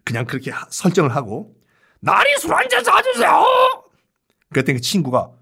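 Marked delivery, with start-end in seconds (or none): 2.0-3.4 a wrecked voice after swallowing charcoal; ruined voice. Shown as a demonstration. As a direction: sound like charcoal burned your throat. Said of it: "이런 목소리를 한다".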